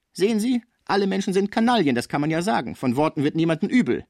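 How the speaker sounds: high-pitched, vehement avowal; heated insistence. Acted. Cibot swears to her companions about the heirs, heated. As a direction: swear it and press the point hard.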